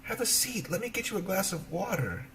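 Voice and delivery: put-on sweet young girl ushering a guest in. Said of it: "she invited the beggar woman in".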